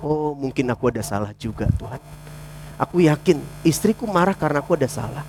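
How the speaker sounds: soft, low prayer; humble confession. Acted. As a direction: confess quietly to God, asking forgiveness. Acted humble prayer for forgiveness. (doa lirih, rendah hati)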